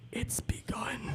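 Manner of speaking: breathless ominous whisper. Retold or imitated. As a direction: hush it like doom arriving. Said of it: "I'm like".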